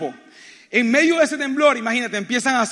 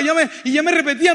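normal talking voice

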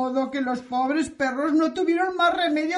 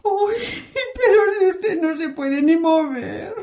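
tearful protest; 6 semitones higher than usual. Acted. Landlady protests he cannot go.

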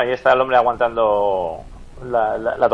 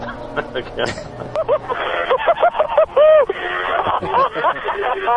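wild laughter. Real, not acted. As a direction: laugh your head off. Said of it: "Se está partiendo".

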